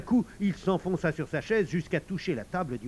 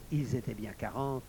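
ordinary delivery, nothing marked

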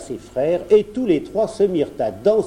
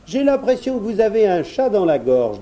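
lightly voiced boy, higher pitch; little prince; 7 semitones higher, 4 dB louder than usual. A narrator's reading. Prince comments on dragon's cough, lightly voiced. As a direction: observe politely in a raised child voice.

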